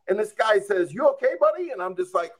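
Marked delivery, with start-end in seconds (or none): 0.9-1.7 higher, kindly voiced trooper; friendly trooper. State trooper asking a fallen man if he is hurt, kindly and higher. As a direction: check on a fallen stranger kindly. Trooper asks after his fall.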